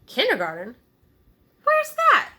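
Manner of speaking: Where's that? leaps very high and loud; bewildered chorus. A narrator's reading. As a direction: burst out in confusion. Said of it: "said the barn animals, almost all at once".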